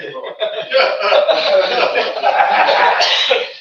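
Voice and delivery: long, high, loud fit of laughter; laughing hard and long. Real, not acted. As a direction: really crack up. Laughing at his own story.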